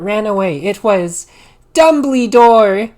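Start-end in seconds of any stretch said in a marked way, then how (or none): 1.7-2.9 slow, loud drawn-out name; dramatic reveal. Acted. Revealing the intruder, slow and loud.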